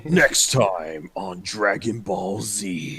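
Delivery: slow, dramatic anime narrator; anime announcer voice. Acted. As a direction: deliver it like a cartoon announcer. Announcer voice, partner reacts.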